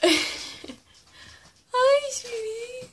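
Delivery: high squeaky pet voice; squeaky praising a dog. Real, not acted. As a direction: coo it way up high. Greeting bird in high voice.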